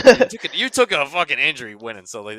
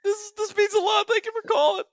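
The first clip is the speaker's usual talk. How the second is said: mock tearful thanks. S1 acts out the tearful reply.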